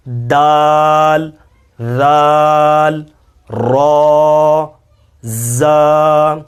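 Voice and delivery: دال ذال را زا each drawn out very slowly; a slow, careful recitation of the letters; letter review. Shown as a demonstration. Teacher repeats the letters for the class. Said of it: "اب دہرا لیتے ہیں".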